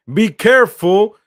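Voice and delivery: sudden loud warning cry; alarmed yell. Real, not acted. Watching a dangerous cross land, a loud alarmed yell. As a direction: shout high and loud as the ball drops in.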